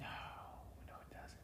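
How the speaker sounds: whispered, teasing no. Real, not acted. Whispering back to his wife, teasing. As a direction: breathe the denial to wife.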